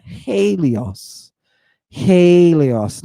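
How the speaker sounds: Helios as "HAY-lee-os" with a breathed h; rough breathing. Shown as a demonstration. Teacher demonstrating the Greek sun god's name, Helios with a breathed "hay". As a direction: sound the h at the front of Helios. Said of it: "It's got a rough breathing in it".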